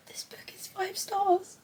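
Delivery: slow, high tearful whisper; crying. Real, not acted. Reader rating the book she just finished, whispering through tears. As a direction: barely get it out, very softly through tears. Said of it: "I don't know why I'm crying".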